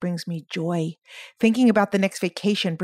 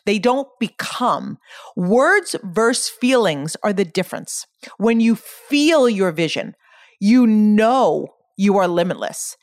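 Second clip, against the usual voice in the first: normal talking voice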